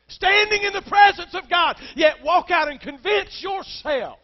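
full-throated pulpit shout; preacher's shout. Real, not acted. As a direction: cry it out to shake the room. Sermon climax shout.

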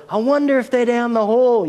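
high, sing-song pretend search; a father pretending to search the hall; mock wondering. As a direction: ham up not knowing where the kids are. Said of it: "so I'd be going down the hall".

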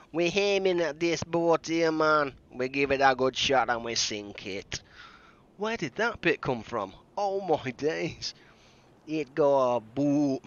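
slow, lightly put-on Jamaican accent; a hammed-up Jamaican patois; bad Jamaican accent. Gamer apologises to Jamaican viewers for terrible Jamaican accent. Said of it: "I truly apologise for my terrible accent".

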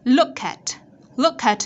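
emphatic teacher demo of linked "Look at"; linking K to A. Shown as a demonstration. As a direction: slide the k of "Look" onto "at". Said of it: "chúng ta sẽ nối âm k".

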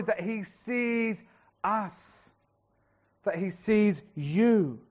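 slow, stretched, each word weighted; drawn-out emphasis. Real, not acted. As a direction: linger on sees. Preacher dwells on El Roi.

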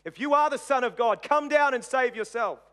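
lightly acted crowd taunting a victim; mocking taunt. A narrator's reading. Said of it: "they mocked him, saying".

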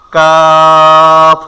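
very slow drawn kaf; letter demo. Shown as a demonstration. Teacher draws out কাফ slowly for students. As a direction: stretch কাফ out long. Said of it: "একটু try করেন".

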